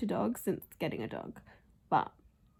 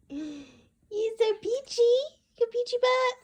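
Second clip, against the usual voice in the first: gushing pet talk; 13 semitones higher, 5 dB louder, slower than usual. Real, not acted. Owner doting on her peachy dog, high baby talk. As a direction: squeal fondly at the puppy, stretching the words.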